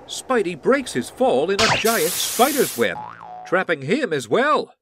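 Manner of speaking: ordinary talk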